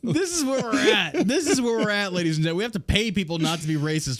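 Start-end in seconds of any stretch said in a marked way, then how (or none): 0.0-2.6 exasperated. Real, not acted. Exasperated opening rant.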